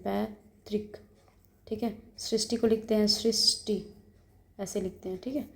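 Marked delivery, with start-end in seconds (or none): 0.0-1.0 पैतृक said slowly and softly, each sound clear; पैतृक pronounced carefully for students; careful spelling demo. Shown as a demonstration. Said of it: "पैतृक को ऐसे लिखते हैं".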